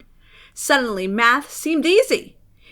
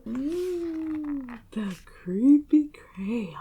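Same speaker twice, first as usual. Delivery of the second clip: slow breathy whispered title; hushed, eerie title read; spooky whisper; 2 semitones higher, 5 dB quieter, much slower than usual. A narrator's reading. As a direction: whisper it like a ghost story.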